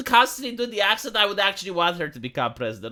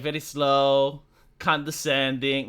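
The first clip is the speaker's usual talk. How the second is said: drawn-out, slow, lower-pitched delivery; slow and condescending; 7 semitones lower, slower than usual. Shown as a demonstration. Imitating her talking to an old person, slow and condescending. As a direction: stretch it out as if for someone very old. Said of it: "talking like, uh, you would talk with a very old person".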